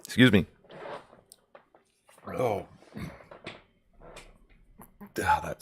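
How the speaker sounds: strained after swallowing wrong way; recovering from swallowing wrong way; swallowed wrong way. Real, not acted. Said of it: "It went down the wrong pipe".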